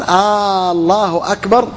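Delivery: الله أكبر opened with a long, level "aa"; lengthened opening hamza. Shown as a demonstration. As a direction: hold a long "aaa" on the first sound of الله, then أكبر. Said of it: "فهو استفهام".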